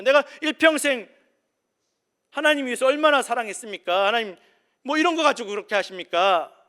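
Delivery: the king's hypothetical protest to God, outraged; indignant protest. Acted. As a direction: argue back at God, self-justifying.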